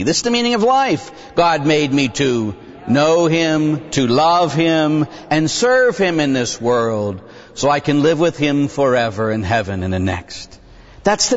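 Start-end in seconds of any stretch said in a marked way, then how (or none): 1.3-9.4 slow, measured catechism question and answer; slow rote recital. Shown as a demonstration. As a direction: recite it slowly like a child learning the catechism. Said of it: "It used to be in the Baltimore Catechism".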